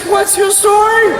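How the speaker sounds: high-pitched, insistent mom voice; mock a mom grilling her son; interrogating mother.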